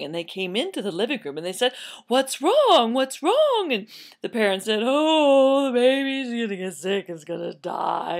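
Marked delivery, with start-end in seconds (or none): 2.1-3.7 high, loud, urgent question; alarmed neighbors. Acted. Neighbors coming into the living room, alarmed. 4.8-8.2 tearful drawn-out wail sinking down; mock crying. Parents telling neighbors, mock wailing.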